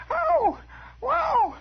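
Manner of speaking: very high, held howl; high drawn-out cry; imitated wail. Howling noise in the rowing story.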